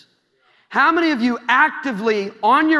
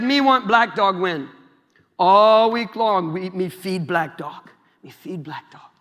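slow, broken-English put-on Indian accent; lightly voiced Indian accent, slowed; mock Indian accent; 4 semitones lower than usual. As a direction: spell out the trick in a hammed-up Indian accent. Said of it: "I don't know if that's an Indian accent".

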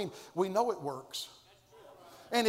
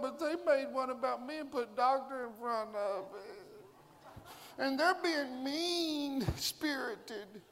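whiny [name]; 4 semitones higher than usual. Acted. [name] mock-complaining to God about Facebook. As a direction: complain in a mock hurt, laughing tone.

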